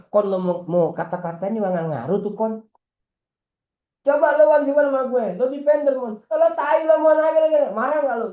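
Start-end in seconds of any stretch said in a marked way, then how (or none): 4.0-8.3 higher and louder, goading trash talk; taunting opponent. Acted. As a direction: needle him like a rival in a one on one.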